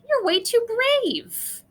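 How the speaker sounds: teasing grandma. Acted.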